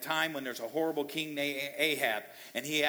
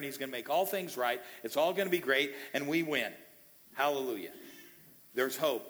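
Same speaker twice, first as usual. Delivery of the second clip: normal talking voice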